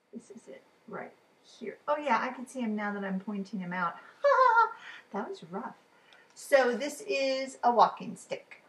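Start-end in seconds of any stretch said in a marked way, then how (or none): 4.2-5.1 short high burst of laughter; laughing after failing to spot the bug. Real, not acted. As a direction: laugh at yourself. Laughing at own struggle.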